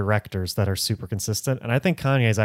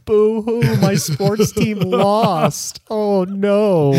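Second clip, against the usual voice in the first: high, loud mock sobbing through a megaphone; fake crying; 12 semitones higher, 7 dB louder, much slower than usual. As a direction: whine it like a fake crybaby. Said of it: "yelling through, like, the megaphone".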